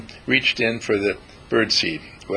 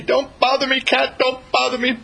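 high, tiny bird voice shooing off the cat; a frightened bird telling the cat to go away; scared little bird; 13 semitones higher than usual. Acted. Bird's imagined angry reply to cat.

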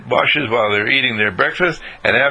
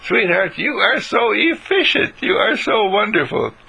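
admiring wife; 10 semitones higher than usual. Acted. Wife praising efficient husband.

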